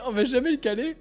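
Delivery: normal talking voice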